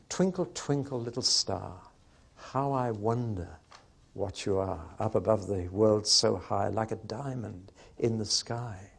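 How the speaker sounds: soft, unhurried nursery-rhyme reading; hushed wonder, falling away softly; childlike wonder. Shown as a demonstration. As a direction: recite it gently, as if wondering at the star. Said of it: "that childlike wonder".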